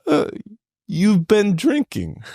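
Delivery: high, slow teasing voice; Spanish guy in line teasing the hungover narrator, high and slow; amused Spanish guy. Retold or imitated. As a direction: tease him slowly and high. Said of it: "he goes".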